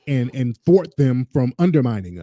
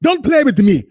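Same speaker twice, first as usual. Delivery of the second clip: high, emphatic imitation of an African accent; mock African accent; 11 semitones higher, 3 dB louder than usual. Host mimicking her comeback, mock African accent. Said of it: "in and out of her little accent".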